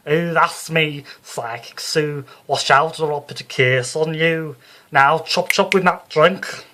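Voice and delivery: loud, high Liverpool medium barking orders; Psychic Sue, bossy. Acted. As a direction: lord it over the landlord, brassy and bold. Sue boasts and threatens landlord.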